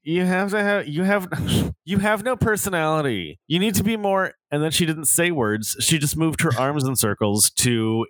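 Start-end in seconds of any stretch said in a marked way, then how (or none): 0.0-4.3 high-pitched imitation of an annoying coworker; put-on high trainer voice; mocking coworker voice. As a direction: mimic her, pitched well up. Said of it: "in this voice, she would say".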